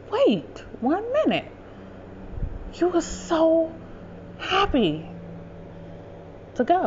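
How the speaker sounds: high, halting bewilderment; mock disbelief, spaced out word by word; lost, baffled mother. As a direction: imitate a confused mother, pausing between phrases. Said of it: "she would be lost in the sauce".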